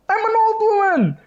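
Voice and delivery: very high-pitched caricature voice; hammed-up old lady squawk; indignant old woman. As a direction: imitate an old woman protesting shrilly. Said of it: "aged forty women just pushed".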